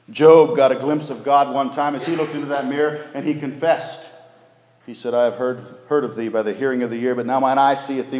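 fervent pulpit delivery; full-force preaching. Acted. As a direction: preach it out to the back pews.

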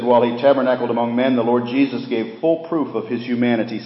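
loud, high preaching of a quoted passage; forceful preaching. Acted. Preacher reading a quote to the congregation, booming. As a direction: proclaim it from the pulpit at full force.

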